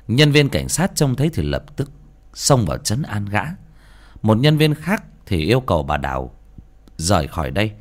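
usual speaking voice

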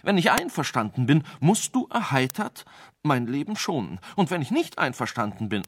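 high, hurried arguing; urgent pleading. Acted. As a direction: argue desperately, no pause for breath. Sage argues for his life.

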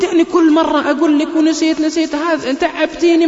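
high, loud playful scolding. Retold or imitated. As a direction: pretend to snap at your wife, jokingly. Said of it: "مثل رفعت صوتي عليها".

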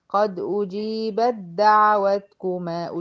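slow قد أجيبت دعوتكما, the ت of أجيبت merged into د; slow Quranic recitation demo; recited merge. Shown as a demonstration. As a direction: recite slowly, ب passing straight to the doubled د. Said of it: "من الب على الدال".

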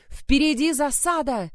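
high, loud warning cry; alarmed shout. A narrator's reading. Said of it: "вскричал".

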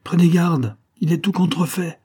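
high, alarmed caution; urgent warning. A narrator's reading. Bonesetter clings to count's arm, high urgent warning. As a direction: call out to stop him.

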